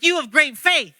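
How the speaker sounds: high, loud exclamation of praise; acted-out admiring proclamation; emphatic acclaim. Jesus acclaiming the woman's faith.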